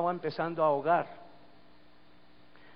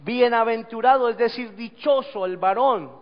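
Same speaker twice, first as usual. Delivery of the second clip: loud, raised proclamation of a psalm; predicación a plena voz; 6 semitones higher, 8 dB louder than usual. Real, not acted. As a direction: declaim the blessing forcefully. Proclaims a psalm at full force. (proclamar el salmo en voz alta)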